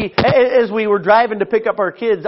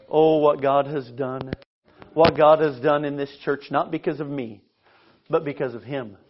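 reverent awe; 6 semitones lower, 6 dB quieter than usual. Real, not acted. Preacher marvels at God's work.

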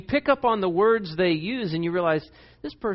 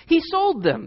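daughters; 12 semitones higher, 4 dB louder, slower than usual. Retold or imitated. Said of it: "they said".

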